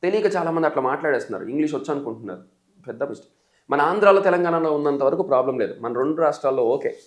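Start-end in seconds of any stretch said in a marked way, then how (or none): none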